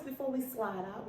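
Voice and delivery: ordinary delivery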